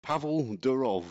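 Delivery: Pavel Durov said slowly in a northern English accent. Shown as a demonstration. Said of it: "In a northern accent".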